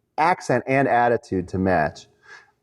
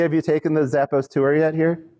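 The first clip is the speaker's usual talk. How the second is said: ordinary delivery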